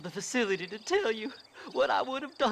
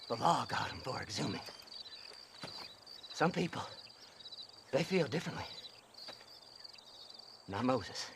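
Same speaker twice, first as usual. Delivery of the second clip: hushed musing; 9 semitones lower, 10 dB quieter than usual. Acted. Softens talking of partner.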